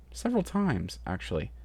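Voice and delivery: ordinary delivery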